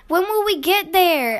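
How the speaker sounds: high, loud little-cub question; eager cubs. A narrator's reading. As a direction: ask like impatient kids in the back seat. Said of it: "asked the cubs".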